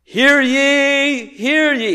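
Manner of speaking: high-pitched, drawn-out public call; town crier. Acted. Mark proclaiming in a town square, high crier's call. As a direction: cry it out across the square. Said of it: "as if he was standing up and was saying".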